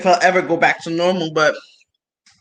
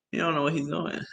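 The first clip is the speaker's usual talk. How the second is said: put-on much softer, under the breath, like kids whispering in class; hushed kids' quote; 2 semitones lower, 11 dB quieter, faster than usual. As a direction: mutter it softly as the kids do. Kids mocking the teacher.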